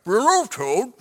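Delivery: mumbled as if through food; mouth full. A narrator's reading. Frog talking to Toad while eating cookies, mouth full. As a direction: pretend to talk round a big bite. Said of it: "said Frog with his mouth full".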